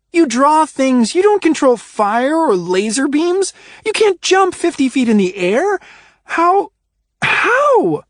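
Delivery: incredulous. A narrator's reading. Ruby demanding how Adrian does it, rising to a squeal. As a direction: climb into a near squeal of disbelief.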